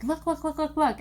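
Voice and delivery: eager urging. Retold or imitated. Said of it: "And she was like".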